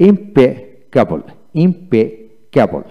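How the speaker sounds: ইমপেকেবল stretched out slowly, each part clear; slow careful word. Shown as a demonstration. Teacher slowly modelling ইমপেকেবল for learners. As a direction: draw out ইমপেকেবল syllable by syllable. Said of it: "তারপর আসেন".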